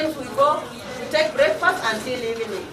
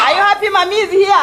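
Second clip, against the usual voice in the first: louder repeat; 5 semitones higher, 6 dB louder, faster than usual. Real, not acted. Repeats question louder to the children.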